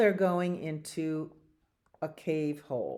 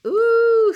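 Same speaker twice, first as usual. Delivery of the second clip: shrieked, held "ooh"; acted squeal at a stink; loud high "ooh"; 13 dB louder than usual. Children meet skunk smell, loud high squealed "ooh".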